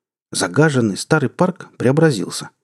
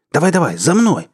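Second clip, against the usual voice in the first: urgent call; 7 semitones higher than usual. Acted. Tom fleeing, urging.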